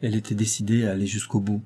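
ordinary delivery, nothing marked

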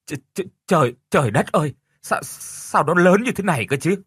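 high, stuttering gasp of amazement; stammering in shock. Acted. Mother seeing the giant carp, stammering in shock. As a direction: stumble over the words, stunned. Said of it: "miệng thì lắp bắp thốt lên".

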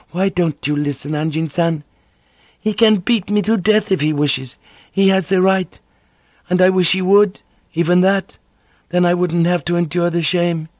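a hint of a proud woman spilling her shame; Mariko, bitter and ashamed. A narrator's reading.

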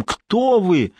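high, feverish call into the dark; anxious shout. Acted. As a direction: cry out the question, alarmed. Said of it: "своим криком".

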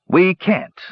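ordinary delivery

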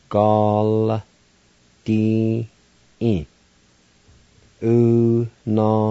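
Unascaldi said slowly, syllable by syllable; Cherokee word for ball field. Shown as a demonstration. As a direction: sound out Unascaldi with a silent I before the L. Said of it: "like this".

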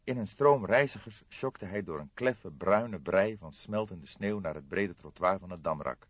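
ordinary delivery

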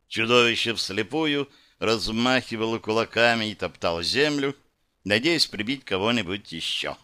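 plain reading voice

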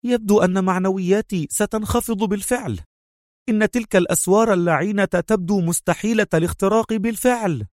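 nervous under-the-breath worry; tense murmur. A narrator's reading. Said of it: "مغمغمة في توتر".

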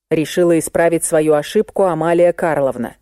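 usual speaking voice

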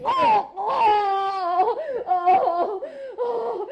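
long drawn-out crying moans; wailing sobs. Acted. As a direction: wail slowly in pain. Beaten wife weeping. (نحيب وتأوه ممدود)

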